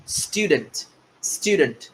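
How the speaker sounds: स्टूडेंट said softly and carefully as a model; correct pronunciation. Shown as a demonstration. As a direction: quietly model स्टूडेंट, each sound clear. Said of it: "उसको हम कहेंगे". (स्टूडेंट का धीमा, साफ़ सही उच्चारण)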